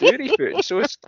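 excited. Acted. Gleefully adding word to dictionary.

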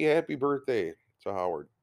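ordinary delivery

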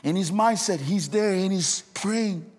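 loud, high, impassioned preaching climax; full force preaching. Acted. Preacher to the congregation, building to a loud, high passionate cry. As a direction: shout the story home to the church, building.

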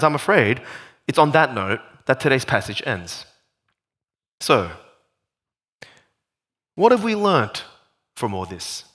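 ordinary delivery

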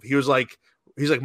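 ordinary delivery

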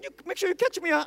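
put-on a toddler afraid of the height; scared little boy. Son on the table begging dad, high fearful child voice.

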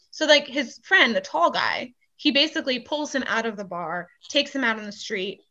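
usual speaking voice

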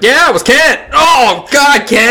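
high, loud fake blame; loudly pin it on Kent; mock accusation. Blaming Kent for own fart.

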